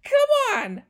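high loud laughing come on; laughing disbelief. Real, not acted. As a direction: squeal it in disbelief. Disbelief at the report.

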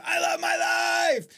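very high, drawn-out shout; full-voiced, slow affirmation yell; scream. Shown as a demonstration. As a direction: scream it up at the ceiling. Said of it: "and scream".

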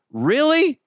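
high, loud incredulous Really; indignant really. Retold or imitated. Asking a walking-by former friend in disbelief. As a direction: throw out a stunned, indignant question. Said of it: "And I was like".

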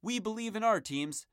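upbeat network ad voice; punchy podcast network plug; promo announcer read. Acted. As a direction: sell it like a radio promo.

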